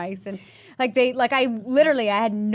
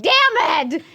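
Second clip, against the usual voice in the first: frustrated outburst; 6 semitones higher, 7 dB louder than usual. Retold or imitated. Trainer denies her carbs.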